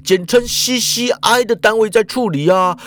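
lightly voiced panicky Japanese man; Ikeda answering on the run. A narrator's reading. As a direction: answer while still running. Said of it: "脚步不停地回说".

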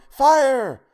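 loud, very high cry of alarm; yelled alarm. A narrator's reading. People fleeing the building, yelling in alarm. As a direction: shout it out long and high to warn everyone. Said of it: "People were yelling".

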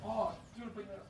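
distant voice, away from the mic; far off. Real, not acted. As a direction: call from across the room. Talking to courier outside, off-mic.

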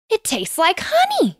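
high, delighted exclamation; girl exclaims at a sweet flower; exclaimed. A narrator's reading. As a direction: burst out with surprise at the taste. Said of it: "she exclaimed".

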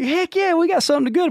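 high excited bragging; excited boast. Retold or imitated. Young guide imagining buddies' praise, excited high crowing. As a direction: crow it like a young hero.

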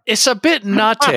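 high, hammy British catchphrase voice; put-on British movie-spy voice; Austin Powers. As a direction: pitch it way up like a comic British spy. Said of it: "stereotypical for going down Austin Powers".

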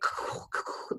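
whooshing crash of debris, unvoiced; voiceless crashing sound effect; imitated debris noise. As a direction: mimic metal rushing past with breathy noise. Imitating flying metal and debris.

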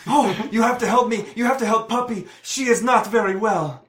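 high, put-on voice, urgent and pitiful; pleading injured man. Injured man begs help for his puppy, high pleading. Plays injured dog owner.

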